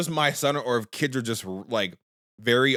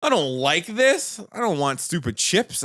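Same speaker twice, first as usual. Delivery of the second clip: put-on whiny kid; 9 semitones higher than usual. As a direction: whine it like a cranky kid. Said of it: "he's like".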